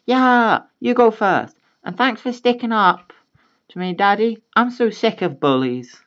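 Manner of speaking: put-on high, light child voice; grateful little girl. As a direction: thank daddy sweetly. Daughter thanks dad.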